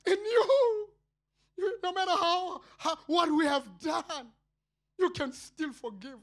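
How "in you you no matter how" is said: higher, louder tearful cry; high, loud crying plea. Real, not acted. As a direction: cry out to God. Crying out to God.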